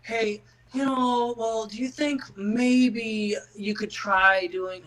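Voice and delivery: slow, tiptoeing mock-polite suggestion; hammed-up overly gentle hint; mock gentle coaxing. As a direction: drag it out like a timid coach afraid to offend. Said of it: "rather than".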